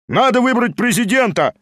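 high, eager call from a crowd; exclaimed shout. A narrator's reading. Members demand a chairman from all sides, calling out. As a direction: call it out over the room. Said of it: "крикнули с разных сторон".

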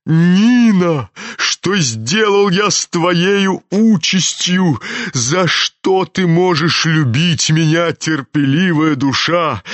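slow, impassioned lament; remorseful old general. A narrator's reading. General calls to his wife Nina, full of remorse. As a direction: cry out to the absent wife.

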